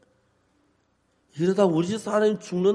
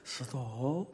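low, much softer calm stop; 8 semitones lower, 17 dB quieter, much slower than usual. Shown as a demonstration. Wife wants him to say stop calmly, soft and low. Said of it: "이렇게 얘기하는데".

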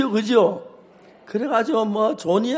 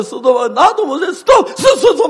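high, loud rising yells of stop; yelling stop; 8 semitones higher, 8 dB louder than usual. Retold or imitated. Pastor admitting he yells at his wife anyway, loud. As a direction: build into a bang of a shout. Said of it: "꽝 지른다".

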